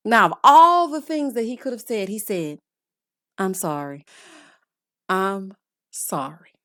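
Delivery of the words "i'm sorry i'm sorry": low, soft, slow mumbled sorry; mock a half-hearted apology; limp mock apology. Host mimicking husband's apology to wife, low and soft.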